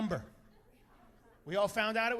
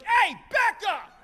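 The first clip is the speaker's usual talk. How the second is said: shout; 10 semitones higher, 7 dB louder, slower than usual. Retold or imitated. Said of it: "he's like".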